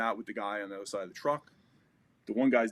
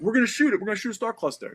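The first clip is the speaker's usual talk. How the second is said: higher and louder, pumped up; buddy hyping the shot, excited; excited call; 11 semitones higher, 5 dB louder, faster than usual. Retold or imitated. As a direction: urge him on, keyed up. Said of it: "He's like".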